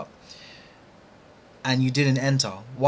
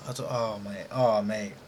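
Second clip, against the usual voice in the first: soft, low, deflated groan of despair; defeated sigh; 6 semitones lower, 9 dB quieter than usual. Real, not acted. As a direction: let the air go out of you. Sighing after missed trade.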